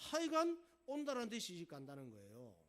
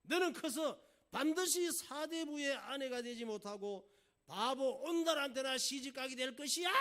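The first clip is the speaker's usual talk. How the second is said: loud, high-pitched royal rant at a daughter; acted angry king's outburst; irritated scolding; 8 semitones higher, 9 dB louder than usual. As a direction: thunder like an exasperated father. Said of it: "짜증스럽게 늘 자주 얘기했대".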